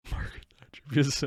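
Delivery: whispered through laughter; barely gets it out through a laugh; wheezing laugh. Real, not acted. Laughing disbelief.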